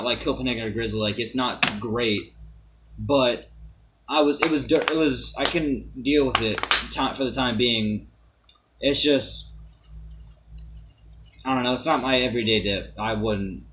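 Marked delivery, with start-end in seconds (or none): none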